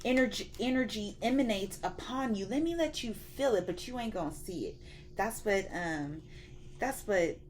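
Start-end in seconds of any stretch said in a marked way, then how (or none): 0.0-4.8 a hyped-up lightly voiced God. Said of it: "He was like".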